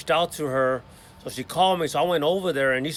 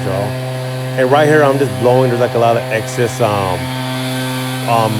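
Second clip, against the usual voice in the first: raised voice over a roaring leaf blower; shouting over blower; 8 dB louder than usual. Real, not acted. Talking to viewers over the blower, loud and raised. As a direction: call it out loud over the machine noise.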